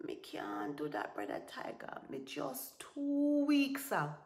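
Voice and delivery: feigned weakness. Acted. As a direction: plead feebly as if too sick.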